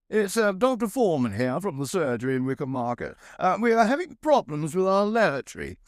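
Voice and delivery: high-pitched, proper caller's voice; doctor on the phone. Acted. Doctor phoning the plumber about the surgery lavatory, formal caller voice. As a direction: state the problem as a formal doctor. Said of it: "said the man on the other end".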